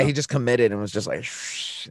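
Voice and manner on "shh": unvoiced hushing shh; shushing sound. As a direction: hush it, breath only. Friend shushing while buzzing hair.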